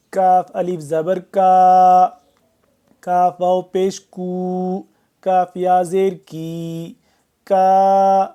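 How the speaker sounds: clear, deliberate کا کو کی; teacher modeling each sound; syllable drill. Shown as a demonstration. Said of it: "teacher drills letter syllables".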